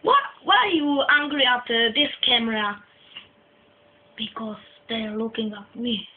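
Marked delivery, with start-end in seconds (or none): 4.2-6.1 sullen low answer. Acted. Sullen answer about the camera.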